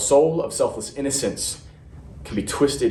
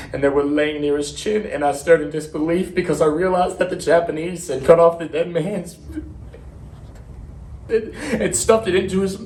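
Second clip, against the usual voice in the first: halting, higher-pitched horrified account; horrified recounting; 5 semitones higher than usual. Acted. Marine describing his mutilated comrades, horrified and faltering. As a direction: stumble through it, voice rising in disbelief.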